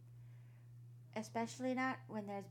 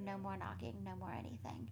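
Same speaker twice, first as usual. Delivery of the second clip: hushed; 3 semitones lower, 8 dB quieter than usual. A narrator's reading. Story falls silent.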